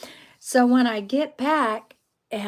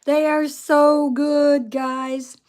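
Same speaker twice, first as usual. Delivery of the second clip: delighted rave; 3 semitones higher, 7 dB louder, slower than usual. Real, not acted. Raving about cherries on camera.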